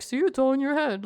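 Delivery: imitating a dismissive doctor; mock smug doctor. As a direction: sneer it like a know-it-all. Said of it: "he'd be like".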